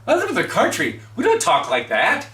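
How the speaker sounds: put-on loud, high-pitched country kid protesting; mock indignant teenager. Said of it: "He goes".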